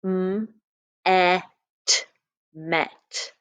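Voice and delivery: "m", short "e", "t", then "met"; sounding out met. Shown as a demonstration. Teacher tapping out "met" sound by sound. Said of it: "Here is the word".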